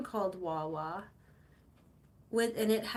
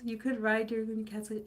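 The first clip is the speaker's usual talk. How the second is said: ordinary talk, nothing marked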